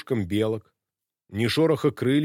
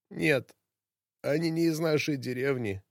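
hoarse voice; 3 semitones higher than usual. Acted. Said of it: "голос его хрипел".

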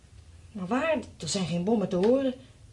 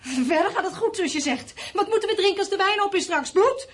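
bitter mockery; 9 semitones higher, 3 dB louder than usual. Wife's bitter mockery turning hysterical.

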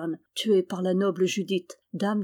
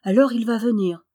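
a touch of eager delight; mildly excited, happy realisation; open joy; 4 dB louder than usual. A narrator's reading. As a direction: brighten with undisguised happiness. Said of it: "sans prendre souci de dissimuler sa joie".

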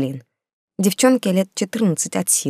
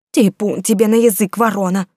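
higher, louder cross retort; angry; 4 semitones higher, 4 dB louder than usual. A narrator's reading. Skinny woman cursing her workmate's gloomy talk, angry. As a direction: scold the doom-monger. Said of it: "рассердилась худая".

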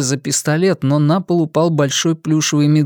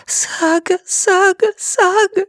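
panicked trapped animal; slower than usual. Acted. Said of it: "снова и снова шепча".

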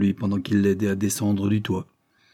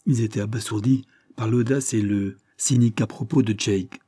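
ordinary delivery, nothing marked

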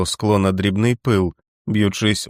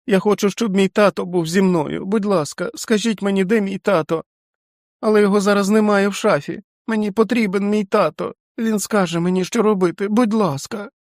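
put-on high tearful child's voice, much higher; a five-year-old pleading through tears; 12 semitones higher than usual. A narrator's reading. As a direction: whimper it like a frightened small boy. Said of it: "сльозливим, враженим голосом п'ятирічної дитини".

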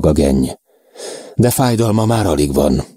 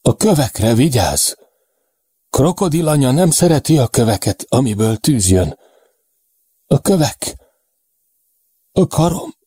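high-pitched fevered warning, breaking into fragments; fading delirious warning; 8 semitones higher than usual. A narrator's reading. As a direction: warn urgently, then drift off word by word. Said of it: "A hangja érthetetlen mormogássá halkul".

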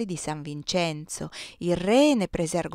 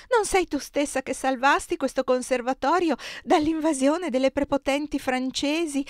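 high-pitched, beseeching appeal; supplicating tone; 7 semitones higher than usual. A narrator's reading. Superiora begging her trusted friend for rescue, supplicating tone. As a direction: implore her help with lofty flattery. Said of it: "esclamò in tono supplichevole".

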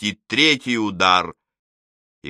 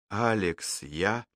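frightened murmur; 9 dB quieter, slower than usual. A narrator's reading. Frightened wife trailing off.

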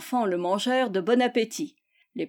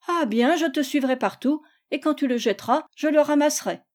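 higher, lightly voiced eager child; determined child; 6 semitones higher than usual. Théodore vows to follow Gisèle, determined. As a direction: announce it with childlike resolve.

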